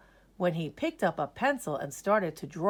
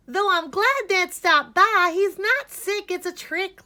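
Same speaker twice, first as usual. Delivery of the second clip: high, brisk nurse voice; high fussy nurse; 12 semitones higher, 7 dB louder than usual. Acted. The nurse tells the doctor it's a trick. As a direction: pitch it up like a bustling nurse. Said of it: "said the nurse".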